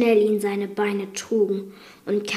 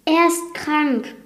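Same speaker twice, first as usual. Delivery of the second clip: pleading; 6 semitones higher, slower than usual. Acted. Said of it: "flehte Belle".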